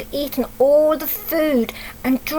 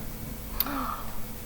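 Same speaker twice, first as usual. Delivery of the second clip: a breathy shocked gasp; soft gasp; 13 dB quieter than usual. Acted. Reader reacting to the tiger eating everything, soft shocked gasp. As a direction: gasp as if shocked at the empty table.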